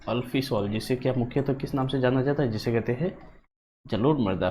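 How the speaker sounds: ordinary delivery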